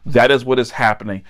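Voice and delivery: ordinary talk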